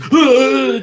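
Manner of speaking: high, loud jolt of breath; startled gasp. Retold or imitated. Dad showing his reaction on waking, startled gasp. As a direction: gasp as if shocked awake. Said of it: "just did this".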